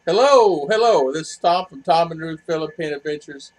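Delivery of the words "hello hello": a high, loud, bright hello to the viewers. Real, not acted. Host greeting his live chat with a loud hello. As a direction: call out the greeting big.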